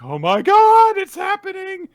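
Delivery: very high, loud fangirl outburst; thrilled mock squeal. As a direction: squeal like your ship just sailed. Imitates Becky thrilled at romance.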